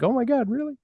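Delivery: high, breathless squeal of surprise; overjoyed newcomer squeaking out his disbelief; amazed disbelief. Retold or imitated. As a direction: play him stunned and thrilled, voice jumping way up. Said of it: "And he's like".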